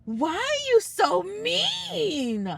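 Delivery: mock-hurt high whine at a rude cyclist. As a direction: plead like a wounded child. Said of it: "I was like".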